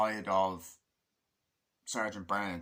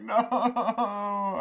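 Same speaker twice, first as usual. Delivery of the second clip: long, high, loud wail; acted screaming onlooker; imitated scream; 9 semitones higher, 7 dB louder than usual. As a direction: drag the cry out in mock horror. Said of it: "there's people screaming".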